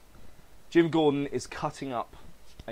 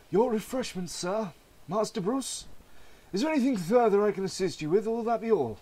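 a lightly voiced butler, pitched higher; mild higher butler voice; polite butler; 11 semitones higher than usual. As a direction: play a courteous old butler offering tea. Alfred serves refreshments.

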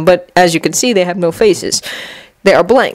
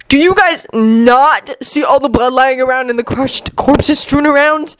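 higher, drawn-out incredulous demand; 6 semitones higher, slower than usual. A narrator's reading. Kankuro challenges their cheer amid corpses, high and demanding. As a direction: stretch it out in exasperation. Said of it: "Kankuro demanded".

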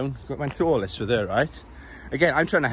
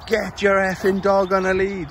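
mock shouted order, pitched up; retold scream; 6 semitones higher than usual. Lorry driver yelling at dog walker, mock shout. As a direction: throw the angry lorry driver's yell back at me. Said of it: "screamed at me".

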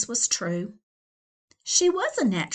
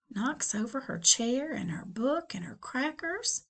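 hushed whisper; whispered aside; 3 semitones higher, 6 dB quieter than usual. Real, not acted. Narrator watching the chaos, whispered aside. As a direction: whisper it like a secret.

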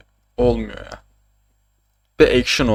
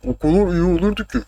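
high, altered mock voice; "o kadar" with slurred, distorted vowels; put-on imitation voice; 7 semitones higher than usual. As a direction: repeat it as a silly higher-pitched impersonation. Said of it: "Bu şeye benzedi".

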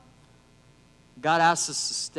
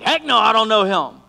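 emphatic denial; 2 semitones higher, 6 dB louder than usual. Retold or imitated. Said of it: "he was like".

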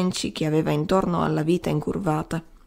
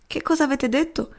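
a touch of a whimper, pitched up; moaning; 7 semitones higher than usual. A narrator's reading. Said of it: "mugolò Carnehan". (gemito lamentoso, voce più acuta)

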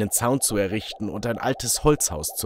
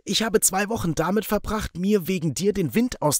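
betrayed friend; 7 semitones higher than usual. Acted. Barry confronts the narrator.

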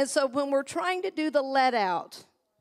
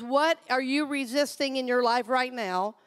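ordinary talk

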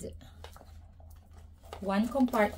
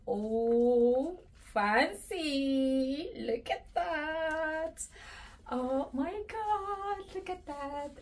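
awed coo; 4 semitones higher, slower than usual. Real, not acted. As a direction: coo over the strap in awe. Admiring the fancy strap.

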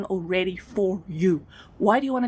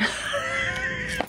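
cackling laugh at her own line; high burst of laughter; 5 dB quieter than usual. Real, not acted.

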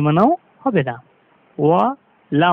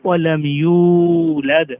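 slow, drawn-out chanted recitation; slow tajweed recitation of আল্লাহু সমাদ; Quran recitation; much slower than usual. Shown as a demonstration. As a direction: recite it slowly with full tajweed. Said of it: "মিলিয়ে পড়লে হবে".

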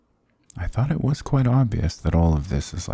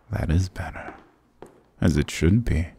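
normal talking voice

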